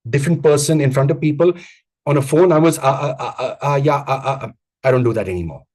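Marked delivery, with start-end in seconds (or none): 2.8-4.5 stammering string of uh; filler words. Shown as a demonstration. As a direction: hesitate on the phone with uh after uh. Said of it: "I was told I was like this on the phone".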